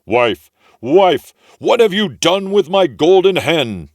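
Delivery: booming high ogre bellow; ogre calling. A narrator's reading. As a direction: roar for his wife like a giant. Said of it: "he heard him calling".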